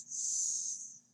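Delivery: long unvoiced hiss; snake hiss. Shown as a demonstration. Showing children the snake sound, hissing. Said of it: "This animal says".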